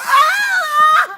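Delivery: sharp scream of pain, high and loud; sudden agonized shout; pained cry. Acted. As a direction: yelp as the spray hits. Sprayed in the face.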